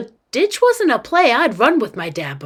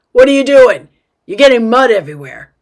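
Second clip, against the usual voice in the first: loud, higher, alarmed scolding; dad's scolding shout; 3 semitones higher, 10 dB louder, faster than usual. Acted. Dad yells at muddy girl in moving van, scolding shout. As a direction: shout at a kid to stop.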